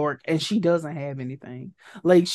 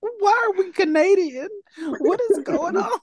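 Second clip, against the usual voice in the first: high-pitched comic disbelief; mock bafflement; 12 semitones higher than usual. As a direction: squeal it in exaggerated confusion.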